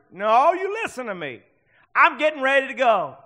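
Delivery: high, loud, emphatic retort; acted-out stubborn insistence; insistent grandmother. Preacher voices grandmother insisting to family.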